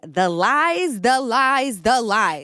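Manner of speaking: the lies, the lies, the lies, hammed up; dramatic repeat. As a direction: ham up the lines like a Housewife. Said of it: "I said".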